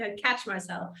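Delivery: usual speaking voice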